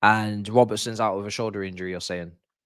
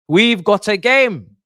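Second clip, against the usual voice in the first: higher, louder, slower shout; more excited; 7 semitones higher, 9 dB louder, much slower than usual. Retold or imitated. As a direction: really belt it the second time. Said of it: "repeated line".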